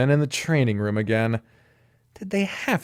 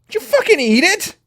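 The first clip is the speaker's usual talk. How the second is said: shocked; 6 dB louder than usual. Acted. Cole shocked.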